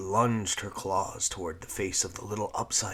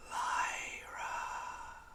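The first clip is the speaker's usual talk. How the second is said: breathy whispered call from nowhere; ghostly whisper; 6 dB quieter than usual. A narrator's reading. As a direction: whisper it like a voice on the wind. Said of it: "came a voice on the tail of the breeze".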